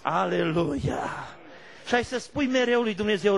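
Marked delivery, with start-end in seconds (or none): 0.0-1.1 slow, loud hallelujah shout; preaching cry. Acted. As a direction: call it out to the church. Preacher's exclamation.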